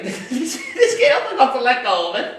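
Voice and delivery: laughing while telling the story. Real, not acted. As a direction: giggle through the words.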